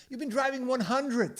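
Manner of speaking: loud, high-pitched accusation; accusing wife. Retold or imitated. Wife accusing husband of speeding, loud accusation. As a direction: exaggerate like a scolding wife. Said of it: "And she says".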